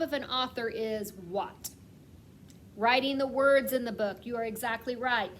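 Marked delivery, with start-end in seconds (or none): none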